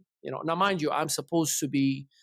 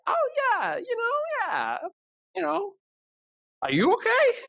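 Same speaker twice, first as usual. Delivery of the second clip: much higher, spaced-out voice, trying to sound fine; stoned self. Retold or imitated. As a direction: play the high guy pretending all is well. Imitating his stoned self.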